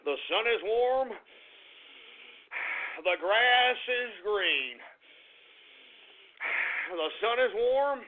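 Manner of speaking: slow, measured, softened recitation; self-calming mantra. Real, not acted. Host trying to calm down after a rant, self-calming mantra. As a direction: recite it slowly to steady the nerves. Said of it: "let me calm down here".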